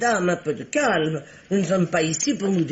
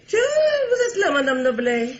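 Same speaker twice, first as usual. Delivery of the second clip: feigned surprise; 11 semitones higher, 3 dB louder than usual. Acted. Feigned surprise at her presence.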